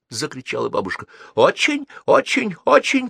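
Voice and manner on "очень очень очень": put-on high, faint old voice down a phone line; thin elderly voice far off on the line; an old man repeating himself over a bad line. A narrator's reading. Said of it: "послышалось в трубке".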